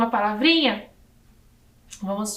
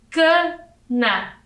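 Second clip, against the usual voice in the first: "Cana" in two beats, higher ca... then na; syllable by syllable; 5 semitones higher than usual. Shown as a demonstration. Teacher showing children two syllables, split apart. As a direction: count out "Cana" with a clear gap. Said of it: "e duas sílabas".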